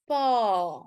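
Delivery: "Pa" with a long, drawn-out A; long vowel. Shown as a demonstration. Teacher repeats "Pa" for learners, long A. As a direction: hold the A in "Pa". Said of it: "it's a long sound".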